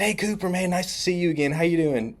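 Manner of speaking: high-pitched chummy hello; fake familiar greeting. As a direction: greet a stranger like an old pal. Prank greeting as an old friend.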